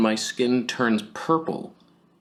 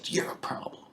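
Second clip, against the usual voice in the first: hissed whisper; 7 dB quieter than usual. Retold or imitated. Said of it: "You go".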